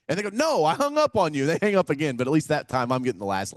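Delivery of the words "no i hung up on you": high, loud imitation of an annoyed caller; acted outraged correction in a raised voice; mock indignant. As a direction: protest in exasperation, hammed up. Imitates annoyed person hung up.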